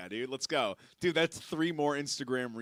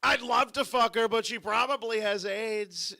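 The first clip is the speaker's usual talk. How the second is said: higher-pitched mock presidential impression; Donald Trump; 4 semitones higher, 5 dB louder than usual. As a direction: do a comic politician impression, pitched up. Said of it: "At least you let me do the Trump impression".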